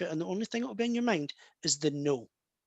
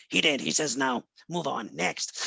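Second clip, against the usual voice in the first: lower, brisk no-nonsense psychic; Sylvia Brown voice; 6 semitones lower than usual. Retold or imitated. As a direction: deliver the verdict and dismiss, next. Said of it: "that was a good Sylvia Brown impersonation".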